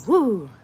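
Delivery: loud high whoop; cheer. Real, not acted. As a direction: whoop for the guy in chat. Cheering a viewer's comment.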